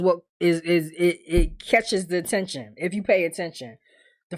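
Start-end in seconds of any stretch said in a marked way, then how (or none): none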